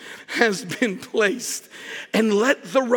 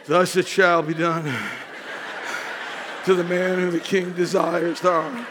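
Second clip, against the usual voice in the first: lower, dark and foreboding; a low, foreboding retelling of the king's proclamation; ominous proclamation; 7 semitones lower, 3 dB quieter than usual. Acted. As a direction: read it like bad news coming. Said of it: "You had to know that proclamation wasn't good".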